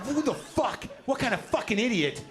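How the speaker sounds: high-pitched, indignant retold outburst; hostile outburst. Comic replaying his thought about a stranger in shorts, hostile. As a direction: act out instant outrage, pitch jumping up. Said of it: "I was that hostile".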